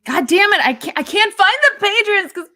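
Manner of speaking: loud exasperated rant; frustrated outburst. Real, not acted. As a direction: vent loudly in frustration. Frustrated at Patreon website.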